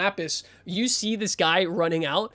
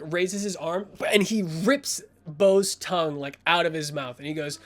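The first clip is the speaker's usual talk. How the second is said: usual speaking voice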